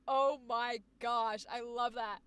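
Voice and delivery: ordinary talk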